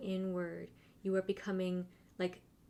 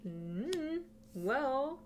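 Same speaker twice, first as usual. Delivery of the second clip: higher, drawn-out pondering hum; voicing the guides; 6 semitones higher than usual. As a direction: voice a hedging reply, pitched up and rising. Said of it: "They're saying".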